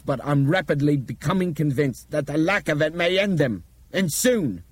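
high, loud, driving speech; intense, vibrating with anger and alarm; angry urgency. A narrator's reading. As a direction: rouse everyone with forceful urgency. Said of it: "His voice vibrated with anger and urgency".

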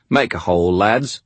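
a light Cockney order to the goons; casual Cockney command; Cockney accent. A narrator's reading. Cockney boss orders his suits aside, light Cockney accent. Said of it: "a man's voice with a heavy Cockney accent".

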